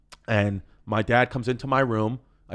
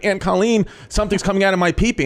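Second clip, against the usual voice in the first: put-on urgent, higher and louder kid calling out; panicked little kid; 6 semitones higher, 4 dB louder than usual. Child runs to his aunt for help, panicked. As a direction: call for help like a startled child.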